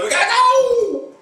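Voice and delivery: very high urgent squeal; mock panicked shout. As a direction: yelp it while fleeing, in playful panic. Acts characters fleeing an attack.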